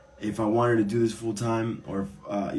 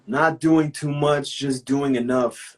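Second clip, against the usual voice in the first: slow, raised, savoring each word; emphatic praise; 5 semitones higher, 7 dB louder, slower than usual. Real, not acted. Host raving to seller about the tee, slow emphatic praise. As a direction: relish every word.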